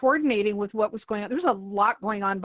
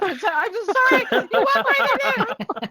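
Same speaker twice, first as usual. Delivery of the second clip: panicky, pitched-up pleading; frantic high apology; 12 semitones higher, 5 dB louder than usual. Retold or imitated. Retold panicked apology to staff.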